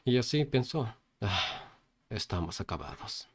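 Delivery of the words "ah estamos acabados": low, soft, resigned murmur; quiet, sunken resignation; disappointed thought. A narrator's reading. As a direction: sigh it out, giving up. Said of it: "lo miró decepcionado".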